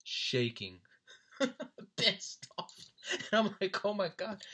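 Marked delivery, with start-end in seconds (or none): 2.0-4.4 high, loud, laughing through the words; cracking up while trying to tell it. Real, not acted. Telling how shaken friend looked, cracking up. Cracks up at his own imitation.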